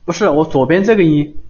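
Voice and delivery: ordinary talk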